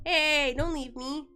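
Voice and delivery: sudden loud plea to a pet; calling out. Real, not acted. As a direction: call him back before he runs off. Calling after cat walking away.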